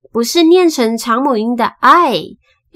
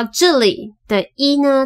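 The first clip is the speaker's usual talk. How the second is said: ordinary talk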